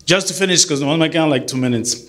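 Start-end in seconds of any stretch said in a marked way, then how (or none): none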